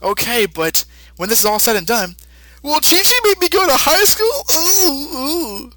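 high, fast cartoon Goku voice, fretting. Goku to the old Kai, high and worried. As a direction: squeak it out in a panic about school. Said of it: "So Goku says".

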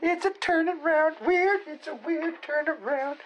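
falsetto squeak, far up the range; hammed-up falsetto parody; high squeaky mock voice. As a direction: mimic someone in a tiny high voice. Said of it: "he said".

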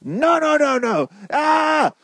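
put-on shrieking toddler tantrum, very high; mock tantrum ending in a scream; little child. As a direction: wail and yell like a refusing child. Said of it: "A little child's like".

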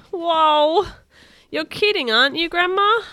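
high, loud panicked yelling; alarmed shout. A narrator's reading. Boy panicking as his shoes carry him home. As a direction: cry out as the shoes run away with you.

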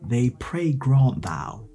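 put-on very slow, theatrical Victorian accent. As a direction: declaim it like an old stage actor. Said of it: "Let me even practice my Victorian accent".

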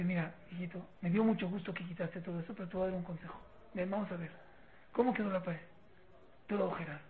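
soft, low, gentle father; tender fatherly advice. Acted. Father advising son at the holed wall, soft and tender. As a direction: counsel your child quietly and kindly.

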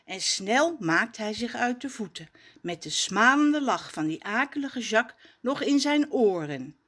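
plain reading voice

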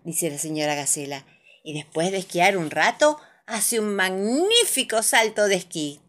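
usual speaking voice

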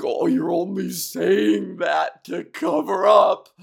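loud, slow mock accusation; loud slow mocking jab; mocking retort. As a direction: sneer it like the teaser. Imitating a teaser's retort.

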